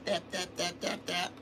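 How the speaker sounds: very soft, staccato imitated taps; controlled light taps. Shown as a demonstration. Showing control of a heavy sledgehammer, hushed tapping. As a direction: tap it out quietly, held back. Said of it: "hold that big sledgehammer and go".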